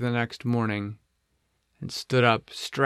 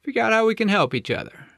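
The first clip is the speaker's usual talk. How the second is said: high, brisk cartoon penguin voice; easygoing penguin character; 12 semitones higher, 5 dB louder, much faster than usual. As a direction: pitch it up as a friendly penguin.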